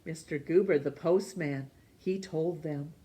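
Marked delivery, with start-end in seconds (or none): none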